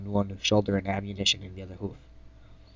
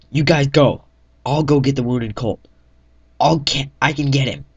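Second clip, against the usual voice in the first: loud, higher call to the squad; heroic shout; 5 semitones higher, 8 dB louder than usual. Acted. Narrator orders squad to go while he rescues Colt, shouting. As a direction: shout them onward and volunteer. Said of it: "I say".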